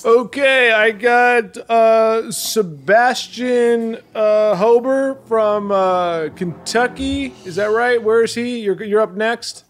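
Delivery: announcer voice. Acted. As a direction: stretch the name like an arena announcer. Host announcing next contestant.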